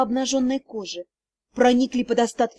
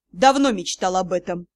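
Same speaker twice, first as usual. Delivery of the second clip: much lower, hoarse male answer; hoarse reply; 7 semitones lower than usual. A narrator's reading. Lord Tier answering the heroine, hoarse and low. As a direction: answer low and rough, no remorse. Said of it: "хрипло ответил лорд Тьер".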